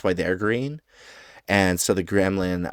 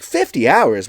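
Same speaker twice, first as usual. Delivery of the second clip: high, loud incredulous echo; acted impressed disbelief; amazed; 6 semitones higher, 11 dB louder than usual. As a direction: repeat it back in astonishment. Gremlin amazed at fifty hours.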